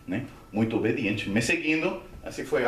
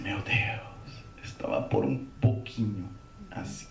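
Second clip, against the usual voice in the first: soft, lower, whispery voice; hushed relief; 5 semitones lower, 6 dB quieter, slower than usual. Retold or imitated. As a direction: say it spent and hushed after a near miss. Exhausted relief after being rescued. (alívio sussurrado, encenado)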